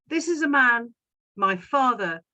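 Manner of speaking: ordinary talk, nothing marked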